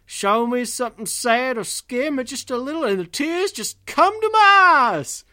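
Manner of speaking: high, loud, hammed-up cowboy wail; weepy cowboy accent. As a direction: build to a mock-tearful cowboy howl. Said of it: "That was pretty good".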